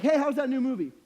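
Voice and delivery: high, loud casual asker; imitated upbeat small talk; chirpy mock question. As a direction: pipe up loudly as an eager friend. Acts out an imagined conversation.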